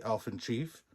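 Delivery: usual speaking voice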